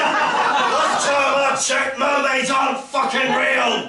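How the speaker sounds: high, loud, drawn-out exasperation; indignant shouted retort. Acted. Comedian answering complaints about the mermaid remake, indignant shout. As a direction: hammer out the comeback slowly and loudly.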